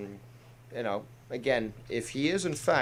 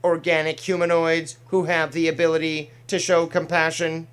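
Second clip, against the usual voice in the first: android voice; 4 semitones higher, 7 dB louder than usual. Pretend. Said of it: "my little impressions there".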